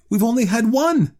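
put-on high, loud excited old woman; urgent reply. A narrator's reading. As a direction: insist eagerly to your husband. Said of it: "she replied rapidly".

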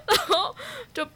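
giggling while admitting she agreed to play; laughing. Real, not acted. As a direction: laugh through the confession. Admitting the absurd part, giggling.

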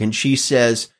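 usual speaking voice

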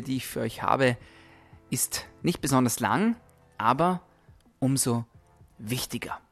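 normal talking voice

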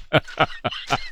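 soft chuckling run; amused, breathy chuckle; laughing. Real, not acted. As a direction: laugh quietly at your own joke. Laughs at own accent imitation.

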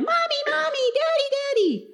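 put-on high childlike calls of Mommy, Daddy; mimicking excited little kids; kids calling out. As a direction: call like a small lost kid in a crowd. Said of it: "kids are running around going".

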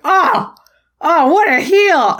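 high-pitched, drawn-out scandalised gasp of words; mock outrage. Fan retelling instant reaction to the villain's dodge, mock outrage.

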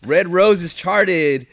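slow, grand announcer read. Pretend. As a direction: announce it like a hype man. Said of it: "I'm trying to get into my announcer mode".

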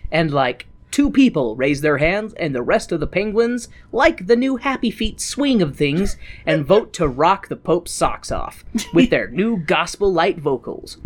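ordinary delivery

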